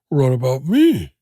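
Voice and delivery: slow, pleading little dog voice, lightly done; a hint of a sad puppy asking; voicing the dog. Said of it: "your dog's gonna be sitting there going".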